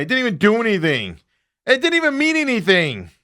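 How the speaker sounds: ordinary delivery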